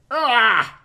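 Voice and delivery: pained cry. Acted. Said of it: "he said".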